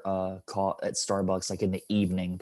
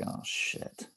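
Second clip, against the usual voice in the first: breathless whisper; an inner whisper of dread; 13 dB quieter than usual. Retold or imitated. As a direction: mutter it under your breath. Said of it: "in my head".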